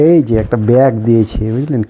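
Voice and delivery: ordinary delivery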